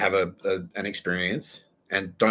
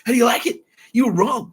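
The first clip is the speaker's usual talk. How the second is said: very high, loud teasing taunt; mock gloating; 8 dB louder than usual. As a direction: crow over the loser in jest.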